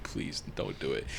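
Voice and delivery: very soft, low whisper; whispered plea. Retold or imitated. Viewer begging a character not to do it, whispered plea. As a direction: plead under your breath. Retelling a hushed, pleading reaction.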